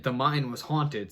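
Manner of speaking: ordinary talk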